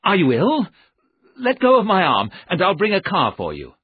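high, loud urgent concern; anxious, pressing cry to someone ill; alarmed crying out. A narrator's reading. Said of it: "I cried".

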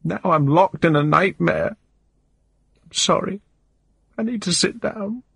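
high, wavering voice, crying through every line; a desperate woman sobbing out her despair; tearful distraught woman. A narrator's reading. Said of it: "but I can't stop crying".

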